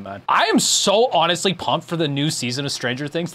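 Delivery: usual speaking voice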